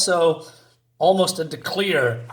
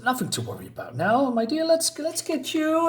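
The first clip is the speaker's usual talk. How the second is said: gentle queen; 9 semitones higher, 4 dB quieter than usual. Acted. Reads Queen Ashley comforting.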